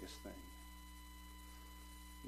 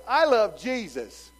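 bold declaration; 7 semitones higher, 14 dB louder, slower than usual. Pretend. Declaring faith at workplace.

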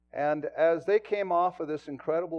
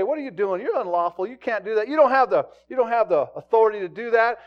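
scolding scribes; 8 semitones higher, 11 dB louder than usual. Retold or imitated. Scribes voiced arguing with disciples.

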